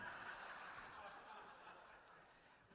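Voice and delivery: soft, airy burst of laughter; breathy laugh. Real, not acted. Pastor cracking up at his own wife story, airy laugh. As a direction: laugh under your breath.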